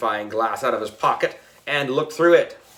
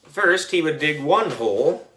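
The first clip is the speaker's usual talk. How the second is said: ordinary delivery, nothing marked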